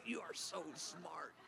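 very soft, slow, almost breathless; hushed whisper. Shown as a demonstration. Preacher whispering his favorite words to God. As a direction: breathe it out in awe. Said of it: "I just love to say".

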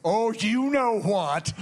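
loud, slow, drawn-out "you know what"; big imitated knowing tease; teasing exclamation. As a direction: draw it out knowingly, with a laugh. Imitates Bob Jones teasing him.

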